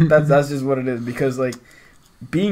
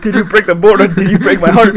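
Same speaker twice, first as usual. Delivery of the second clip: loud, higher, through laughter; laughing mock quote; 4 semitones higher, 7 dB louder than usual. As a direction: deliver the joke while cracking up. Joking mock quote amid shared laughter.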